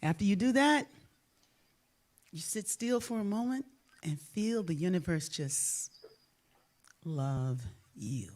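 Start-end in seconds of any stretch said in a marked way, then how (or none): 2.3-8.3 softly, with long pauses, fading into a whisper; hushed guidance. Shown as a demonstration. Guiding listeners to feel the universe's love, hushed and slowing. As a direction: let it settle into near silence.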